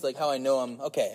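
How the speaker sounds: ordinary talk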